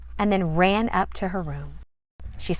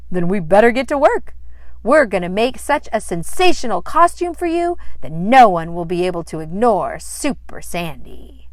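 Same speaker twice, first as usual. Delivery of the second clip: determined enthusiasm; 9 dB louder than usual. Acted.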